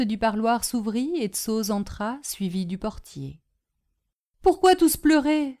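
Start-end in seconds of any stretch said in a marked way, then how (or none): 4.4-5.6 high, loud, puzzled cry; anxious outburst from a simple servant; concerned exclamation. A narrator's reading. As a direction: call out in surprise at the tears. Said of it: "s'écria Tsoz".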